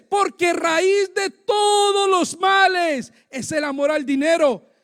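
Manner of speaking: loud, with TODOS stretched long; slow declamation, punching a drawn-out "todos"; forceful scripture emphasis. Real, not acted. Preacher repeating the verse on love of money, forceful and loud.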